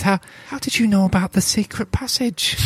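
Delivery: a startled captive dwarf asking; surprised dwarf prisoner. Acted.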